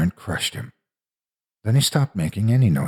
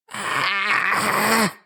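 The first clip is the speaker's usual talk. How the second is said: high, acted scream of pain; 12 semitones higher than usual. A narrator's reading. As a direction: scream as the shock hits. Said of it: "he screamed".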